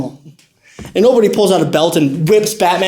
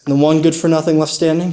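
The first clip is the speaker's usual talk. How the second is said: ordinary delivery, nothing marked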